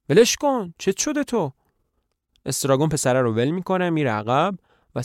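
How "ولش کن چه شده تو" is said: scolding. Acted. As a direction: snap at a friend to let go. (سرزنش با صدای بلند و زیر)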